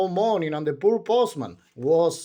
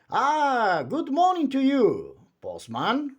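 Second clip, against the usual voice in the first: Mister Chatterbox; 10 semitones higher, 3 dB louder than usual. A narrator's reading. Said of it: "replied Mister Chatterbox".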